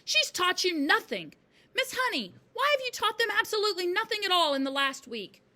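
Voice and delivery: loud, high, triumphant accusation. A narrator's reading. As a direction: crow it loudly at the teacher.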